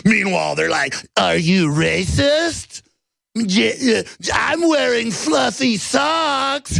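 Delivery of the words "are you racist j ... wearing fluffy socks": mock innocent. Mocking tech elites' innocence.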